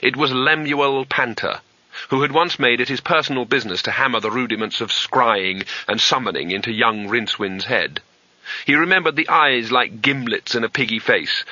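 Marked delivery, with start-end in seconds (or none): none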